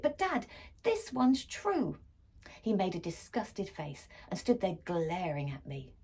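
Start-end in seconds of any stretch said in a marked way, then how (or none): 0.0-2.0 higher, slow insistent plea; pleading insistence. A narrator's reading. Girl insisting to her dad, higher and slow. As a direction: insist it's true, drawn out. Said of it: "I shrieked".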